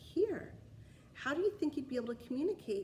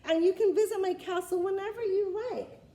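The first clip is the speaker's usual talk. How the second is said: higher, louder welcoming king; generous king; 9 semitones higher, 8 dB louder than usual. Acted. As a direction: invite the dragon warmly, like a king. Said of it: "said the king to the dragon".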